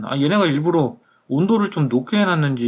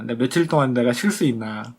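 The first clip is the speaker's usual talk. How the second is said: ordinary talk, nothing marked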